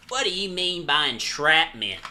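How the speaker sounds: cop question with a Southern twang; Southern-accented cop. Acted. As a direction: ask it as a Southern lawman. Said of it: "Eh, that was okay".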